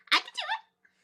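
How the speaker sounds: very high, squeaky little voice; tiny voice. A narrator's reading. A tiny muskrat speaks from inside the boat, tiny high voice. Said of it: "there was a tiny voice".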